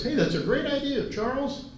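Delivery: high-pitched impression of an oilman refusing; high, drawn-out mimicry; Mike. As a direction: put on his high voice, stretching out the refusal. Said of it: "That sounds like Mike".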